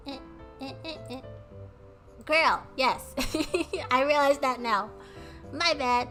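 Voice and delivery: ordinary talk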